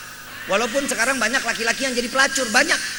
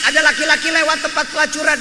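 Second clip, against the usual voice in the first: usual speaking voice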